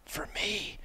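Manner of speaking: quick soft whispered reply; whispering. Retold or imitated. Cameraman answers the hunter about the buck, whispered. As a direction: mouth it with just breath.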